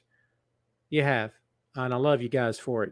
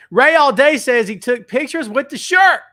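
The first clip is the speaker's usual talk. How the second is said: excited; 9 semitones higher, 10 dB louder than usual. Real, not acted. Excited reading of chat.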